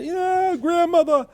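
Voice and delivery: high squeaky imitation of a crying girl; mock sobbing granddaughter. Scammer posing as granddaughter on phone, mock sobbing. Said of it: "Through sobs".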